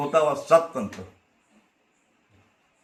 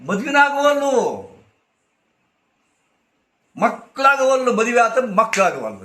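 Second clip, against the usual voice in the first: raised exclamation; 7 semitones higher, 6 dB louder than usual. Acted. Preacher exclaims.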